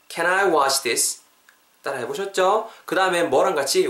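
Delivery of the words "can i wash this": "Can I wash this" joined up as one chunk; linked pronunciation. Shown as a demonstration. Teacher repeating once more for learners, linked "Can I wash this". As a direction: glide from "Can" into "I" and on through "wash this". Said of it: "쭉 붙여서 발음해 볼 테니까".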